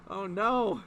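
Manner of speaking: usual speaking voice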